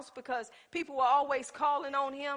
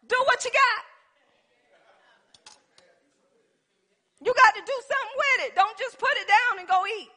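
loud, high, driving preacher voice; full-force preaching; 9 semitones higher, 9 dB louder than usual. Acted. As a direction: preach it hard, building to the word. Pastor preaching hard to congregation.

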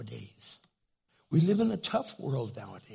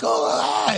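shocked shiver; 6 semitones higher, 8 dB louder than usual. Retold or imitated. Freezing after hot flash.

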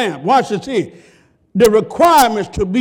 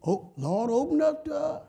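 hushed, begging Lord Lord; soft plea; 6 semitones higher, 8 dB quieter than usual. Acted. Preacher voices the virgins begging softly at the door. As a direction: beg quietly at the shut door.

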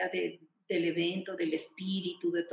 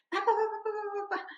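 imitated rapid chatter; 13 semitones higher than usual. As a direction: rattle off syllables like nonstop speech. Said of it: "hablar muy, muy rápido". (imitando un parloteo muy rápido)

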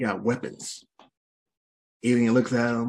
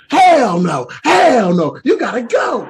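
loud, high-pitched hammed-up refusal; outraged shouting; 9 semitones higher, 9 dB louder, slower than usual. Man throwing out the nosy alien, hammed-up outraged shout. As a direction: shout it as a comic, horrified rejection.

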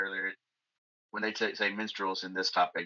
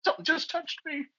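mock scared. Mock spooked by ghost story.

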